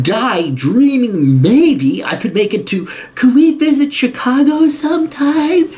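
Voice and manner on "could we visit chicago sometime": high, slow mocking plea; mock wistful dreamer. Parent dreaming of a trip to Chicago, mocked wistfulness. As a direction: draw it out high like a timid wish. Mocks a timid small dream.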